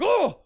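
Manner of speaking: high, loud jolted yelp; startled cry. Retold or imitated. Man touched while hearing defibrillator, startled cry. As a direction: yelp as if shocked.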